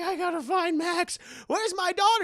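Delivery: high mock-frantic worry; imitated panicked dad. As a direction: pitch it up as a frightened father.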